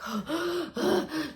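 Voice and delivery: high-pitched suffocating gasp; reenacted gasp for air. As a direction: act out catching breath after nearly suffocating. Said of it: "suffocate or pass out or something".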